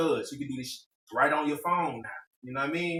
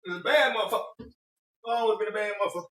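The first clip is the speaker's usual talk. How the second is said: fake tough guy swagger; 7 semitones higher, 3 dB louder than usual. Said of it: "What was Bernie Mac say?".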